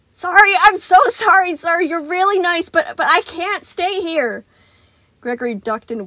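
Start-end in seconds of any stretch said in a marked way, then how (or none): 0.1-4.4 high, loud, stammering sorry; guilty apology. A narrator's reading. Gregory fleeing Sun, high and apologetic. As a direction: apologize while running away.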